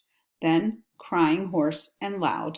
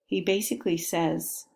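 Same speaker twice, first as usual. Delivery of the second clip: ordinary delivery, nothing marked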